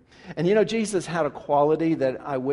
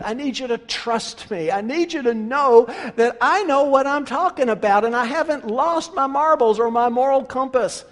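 earnest, higher plea to a friend; pleading Jesus; 8 semitones higher, 5 dB louder than usual. Acted. Jesus pleading with Peter to trust him. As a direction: urge him to trust you, pressing.

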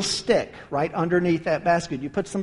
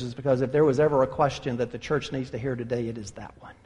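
urgent whisper; 8 semitones lower, 7 dB quieter, faster than usual. Real, not acted. Preacher urges the church, hushed.

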